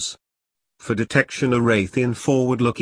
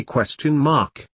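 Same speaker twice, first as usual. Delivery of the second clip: ordinary delivery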